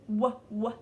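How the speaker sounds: "w" said as a short "wuh", twice; letter sound demo. Shown as a demonstration. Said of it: "w sound of W".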